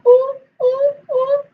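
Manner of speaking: very high, teasing little hoots; mock high-pitched oohs. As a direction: squeal out mocking oohs. Mimics woman cavorting with Elon.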